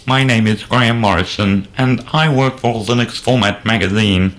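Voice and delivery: silly flat robot voice. Pretend. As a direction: speak like a goofy robot. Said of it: "I can do a silly robot voice".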